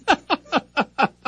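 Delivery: high laugh. Real, not acted. Laughs at repeating producer's cue.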